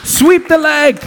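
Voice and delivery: yelled very high and loud; movie quote shout. Retold or imitated. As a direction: bark the line like the ruthless sensei. Karate-Kid-Zitat gerufen. (Filmzitat, sehr hoch und laut gebrüllt)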